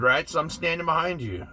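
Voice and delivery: ordinary talk, nothing marked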